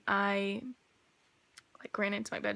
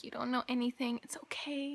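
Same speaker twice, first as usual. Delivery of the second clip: hushed, patronizing "oh sweetie" voice set higher; condescending whisper; 3 semitones higher, 4 dB quieter than usual. Retold or imitated. Stepmoms dismissing her worries about the cabinets, patronizing whisper. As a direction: talk down to her in a breathy hush.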